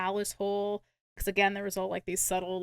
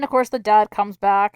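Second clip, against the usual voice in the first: ordinary talk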